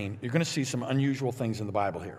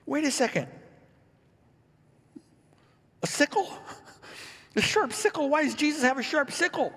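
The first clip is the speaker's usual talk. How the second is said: hammed-up disbelief with a laugh; mock surprise; 12 semitones higher than usual. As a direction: stop short as if you misread it. Sudden mock double take.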